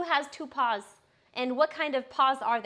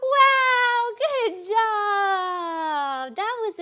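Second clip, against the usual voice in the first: high, loud squealing praise; excited praise; 10 semitones higher, 10 dB louder, much slower than usual. Real, not acted. Owner cheering her puppy for rolling over, squealing with joy.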